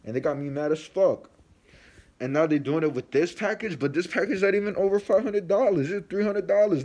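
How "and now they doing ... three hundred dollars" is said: much higher, louder furious complaint; angry rant. Real, not acted. Vlogger venting at delivery guys, pitched up and furious. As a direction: let the anger peak. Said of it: "that's my little rant".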